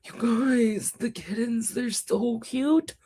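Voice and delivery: high, choked-up squeal over cuteness; nearly crying with adoration, high voice; real happy tearing up. Real, not acted. Gushing to viewers over kitten photos, tearful high coo. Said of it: "literally tearing up. I'm gonna cry".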